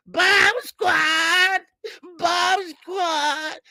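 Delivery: high, loud chanted cheer; fans cheering. Retold or imitated. Old women in the street cheering for Wilder, high and loud. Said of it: "old women walking down the street".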